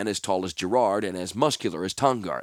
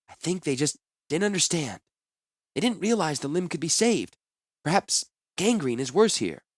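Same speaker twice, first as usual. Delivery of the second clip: gentle healer; 7 semitones higher than usual. A narrator's reading. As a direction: soothe a wounded man patiently. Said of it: "trying for hours to calm the man".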